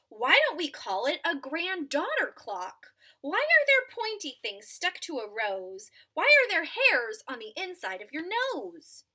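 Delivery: a hint of a small child asking; curious child. Ada firing questions at her parents, lightly voiced curious child. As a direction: rattle off questions eagerly.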